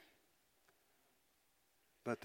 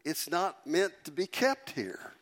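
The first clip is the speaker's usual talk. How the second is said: ordinary delivery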